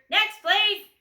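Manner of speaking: loud, high call; loud dismissal. A narrator's reading. Grocer loudly calling the next customer.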